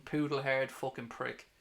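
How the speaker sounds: ordinary talk, nothing marked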